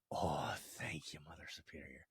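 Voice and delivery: low, slow, near-whispered thanks; hushed thanks. Acted. Leprechaun thanking Mother Superior after a no, low and hushed. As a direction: let it sink away softly.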